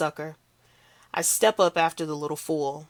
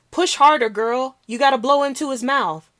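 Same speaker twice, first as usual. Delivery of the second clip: high, loud frantic instruction; urgent shout; 8 semitones higher, 6 dB louder than usual. Acted. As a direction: yell directions over the chaos. Bystander coaching CPR.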